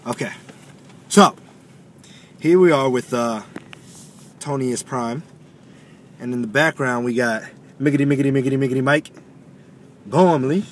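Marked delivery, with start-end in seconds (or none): 1.1-10.7 loud, hyped-up introductions from nerves; nervous showboating. Real, not acted. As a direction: introduce everyone with overdone, nervous bravado. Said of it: "why am I talking like this".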